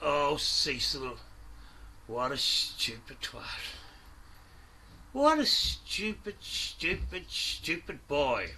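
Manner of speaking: low, soft, slow, withering; contemptuous scorn. Real, not acted. Host sneering at Cecil, low and withering. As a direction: drip with contempt.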